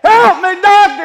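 high, full-force scream of a patient in agony; imitating a patient's frantic screams; screaming for help. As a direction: scream desperately for the doctor. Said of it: "he was just screaming".

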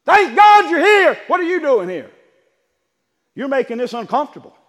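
high, loud welcome turning uneasy; torn demoniac. Acted. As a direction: greet him gladly, then squirm. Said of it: "fell down before him and worshiped him".

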